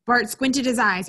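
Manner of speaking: usual speaking voice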